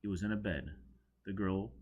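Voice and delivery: ordinary delivery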